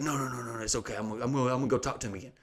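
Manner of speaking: soft, rapid placating run; retold soothing manager. Manager promising to talk to the man, hushed retold soothing. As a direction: hush him quickly, imitating the manager.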